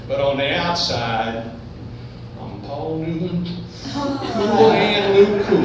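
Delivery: ordinary delivery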